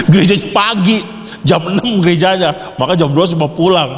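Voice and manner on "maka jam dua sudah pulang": ordinary talk, nothing marked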